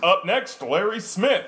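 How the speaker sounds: loud, high host introduction; stage announcer. Retold or imitated. Host announcing the next comic, loud and high. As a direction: call the next act to the stage.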